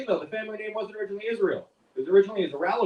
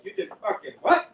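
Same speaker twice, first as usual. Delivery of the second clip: incredulous. Acted. Son appalled at mom's list.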